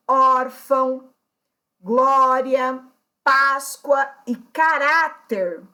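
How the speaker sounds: "órfão, glória, Páscoa e caráter" with ÓR, GLÓ, PÁS, RÁ punched; stress on penultimate syllable. Shown as a demonstration. Teacher giving paroxytone examples, penultimate syllable stressed in órfão, glória, Páscoa, caráter. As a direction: separate words, each next-to-last syllable hit hard. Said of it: "penúltima sílaba".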